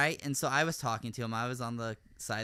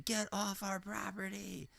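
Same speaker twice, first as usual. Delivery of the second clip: high, drawn-out scream held down in volume; mock scream; 7 semitones higher, 7 dB quieter, slower than usual. Lady in car at man on driveway, mock scream. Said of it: "starts screaming".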